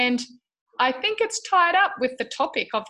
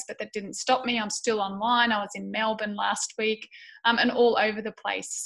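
normal talking voice

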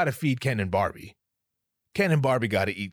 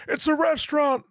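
put-on high, thin old man voice, then a shout; hammed-up high old man shouting; 3 dB louder than usual. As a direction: squeak it out like a frail old guy.

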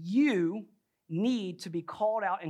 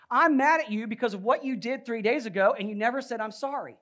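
loud, higher-pitched mock complaint; retold anger; 6 semitones higher, 7 dB louder than usual. Husband tells wife what he is mad about, retold anger. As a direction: re-enact being mad at your wife, voice raised. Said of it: "I'm still upset and angry".

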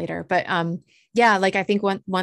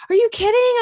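very high, loud delighted squeal; thrilled disbelief; 8 dB louder than usual. Retold or imitated. Hearing she got the appointment, thrilled squeal. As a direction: shriek with happy disbelief. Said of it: "And I'm like".

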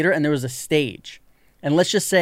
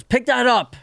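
higher, commanding cop voice; stern cop; 9 semitones higher, slower than usual. Retold or imitated. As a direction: order it like a cop on the street. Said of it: "the guy goes".

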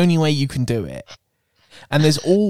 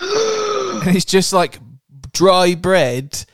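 long, high, outraged "just"; drawn-out disgust; 5 semitones higher, 3 dB louder, much slower than usual. Real, not acted. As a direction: stretch it out, appalled. Disgust at dry bread.

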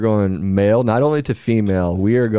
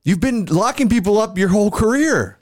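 mock angry; 11 semitones higher than usual. Mock quarrel with inmate client.

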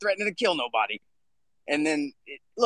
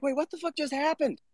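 replaying his stunned reaction to being called a bully; incredulous disbelief; 9 semitones higher than usual. Reacting to the bully accusation, incredulous and much higher. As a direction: pitch jumps way up, baffled and indignant. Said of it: "I'm like".

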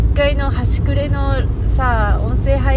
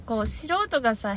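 usual speaking voice

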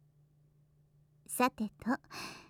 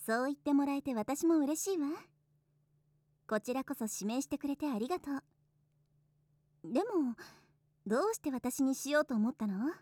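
soft and tender; 7 dB quieter than usual. Acted. Soft intimate talk.

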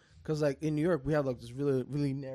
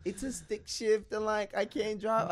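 put-on much higher, slow girly voice; imitating a girl; 6 semitones higher, slower than usual. Retelling the stranded driver's reply, high slow girly voice. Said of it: "she's like".

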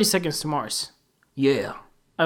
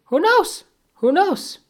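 teasing sing-song; 13 semitones higher, 5 dB louder, slower than usual. Pretend. Teasing about farting.

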